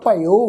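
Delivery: "ਭਇਓ" as bha-i-o, ending on a long o; y as short i. Shown as a demonstration. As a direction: glide "ਭਇਓ" through a short i into o. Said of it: "ਈੜੀ ਨੂੰ ਸਿਹਾਰੀ ਵਾਂਗ ਉਚਾਰਨ".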